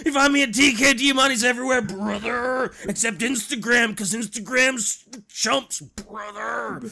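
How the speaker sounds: loud hyped wrestler shout; wrestling promo. Acted. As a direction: pump it up like a ring promo. Outro in wrestler voice.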